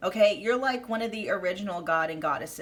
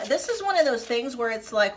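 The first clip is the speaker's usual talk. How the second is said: usual speaking voice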